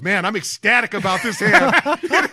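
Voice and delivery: loud, high, drawn-out mock delight; imitated teasing. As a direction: tease a buddy with his own gushing words. Buddies teasing with his own words.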